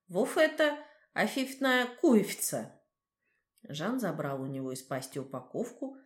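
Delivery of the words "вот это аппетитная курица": mumbled through a mouthful, slow; lisping with clenched jaws. A narrator's reading. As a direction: speak with jaws clenched on food. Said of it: "прошепелявил". (шепелявит сквозь сжатые челюсти)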